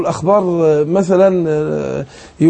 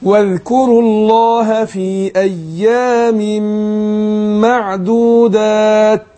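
slow chanted tajweed reading with the final ت of معدودات whispered; measured recitation in a teacher's demo; slow Quran recitation; 2 semitones higher, much slower than usual. Shown as a demonstration. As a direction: recite it slowly, like a teacher showing the rule. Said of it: "أقول".

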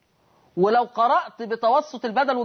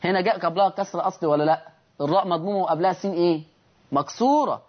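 ordinary delivery

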